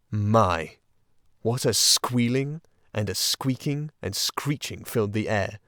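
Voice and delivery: ordinary delivery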